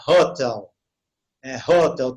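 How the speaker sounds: "hotel" with a breathed H; aspirated English H. Shown as a demonstration. Teacher modelling the next word, "hotel" with audible H. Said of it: "sonho de H".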